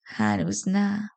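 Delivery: slow, soft tease; lightly voiced drunk man, very slow; drunken lecher. A narrator's reading. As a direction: draw it out softly, toying with her. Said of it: "با دهان بسته خندید".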